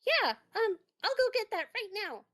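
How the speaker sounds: high, quick little mouse voice; high-pitched, hurried toon helper. Acted. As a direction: answer eagerly in a high cartoon voice. Mouse toon answers the doctor.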